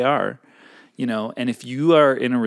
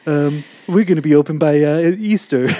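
Trump-like impression; 6 semitones higher, 7 dB louder than usual. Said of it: "my sexy voice sounds like Donald Trump".

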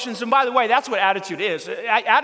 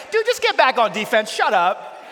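loud retort; 4 semitones higher, 4 dB louder than usual. Retold or imitated. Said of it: "And it's like".

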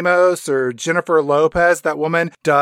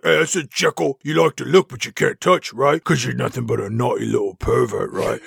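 taunting thug voice; leering villain taunt; sleazy Hyde; 4 semitones lower than usual. Retold or imitated. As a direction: needle him like a bully. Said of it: "Mister Hyde's like".

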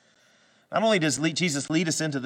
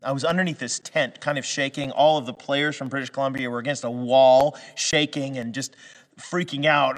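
ordinary delivery

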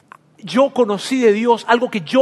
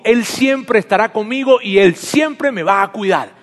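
ordinary talk

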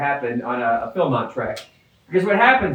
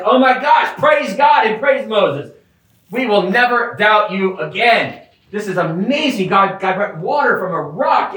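overjoyed praise; 5 semitones higher than usual. Retold or imitated. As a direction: shout praise like a fickle crowd. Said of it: "they're like".

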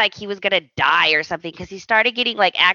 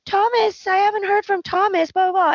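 high, put-on whiny girl voice; mock whiny Steffi; 12 semitones higher than usual. Steffi moping to friends about Thomas, high mock whine. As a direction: mimic her moping about Thomas, pitched way up. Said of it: "And she's like".

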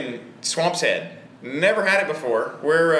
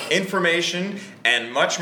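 normal talking voice

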